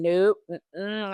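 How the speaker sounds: normal talking voice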